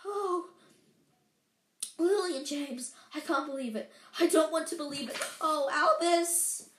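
a hint of a stricken professor; distraught McGonagall. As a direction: lift the pitch a little, pained disbelief. McGonagall reacts to tragic news.

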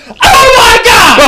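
very high, loud, slow shriek of alarm; shocked scream. Retold or imitated. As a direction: mom screaming at flamboyant pants. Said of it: "she went".

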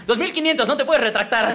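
high, loud cartoon dad haggling; hasty laughing cartoon bargain; Homer Simpson doing business. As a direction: close the deal like a goofy cartoon dad. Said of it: "como Homero haciendo negocios".

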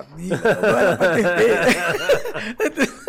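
laughing through the words, slow. Real, not acted. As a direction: crack up while saying it. Laughs at his own imitation.

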